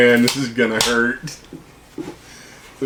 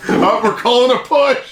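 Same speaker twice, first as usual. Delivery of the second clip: laughing callout; 9 semitones higher than usual. Real, not acted. Calling a push in game.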